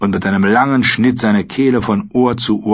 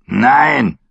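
forceful, higher growl of no; growled refusal; 4 semitones higher, 4 dB louder than usual. A narrator's reading. Craster stands with his axe, growled refusal. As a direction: growl it as a hard veto. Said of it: "knurrte er".

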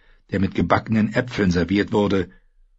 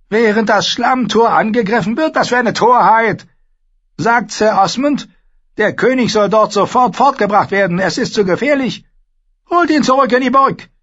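high, loud, imperious queen voice; Cersei, haughty queen; 14 semitones higher, 8 dB louder than usual. Acted. Queen lecturing Sansa at the feast, haughty and loud. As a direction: play the proud queen lecturing the girl.

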